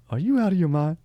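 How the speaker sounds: incredulous game-show host, pitched high; scandalized, exaggerated disbelief; Steve Harvey. Host reacting to a perverted answer, mock-outraged impression. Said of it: "It's my Steve Harvey".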